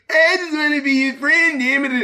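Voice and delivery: whiny nasal imitation. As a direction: mimic a nasal whine. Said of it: "he's doing that nasally voice".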